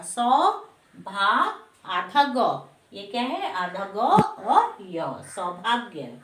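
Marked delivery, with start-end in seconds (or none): none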